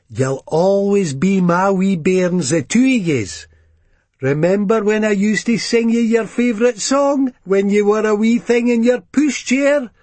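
doting mum reminiscing; a hint of a fond old mam; Ma's voice. Ma speaking to her sons.

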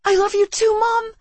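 high, loud anguished cry. Acted. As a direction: cry out to mom in despair. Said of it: "I cried".

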